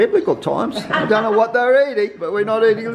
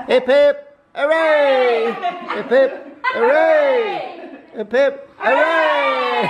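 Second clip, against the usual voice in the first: laughing cheer, higher, shouted; hooray cheer; 5 semitones higher, much slower than usual. Real, not acted. Repeat cheer after candles.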